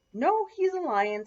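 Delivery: high, loud contradiction; insistent. A narrator's reading. Matthew contradicts his teacher, high insistent voice.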